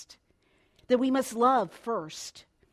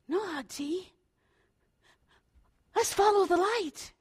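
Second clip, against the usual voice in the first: put-on little girl's voice; 8 semitones higher than usual. Granddaughter pulling grandparent along.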